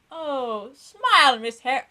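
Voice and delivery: high, loud mocking teacher. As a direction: mimic the teacher's cheery nag. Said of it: "would be like".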